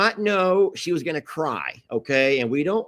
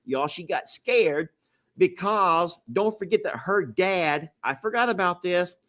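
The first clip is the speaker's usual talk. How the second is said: usual speaking voice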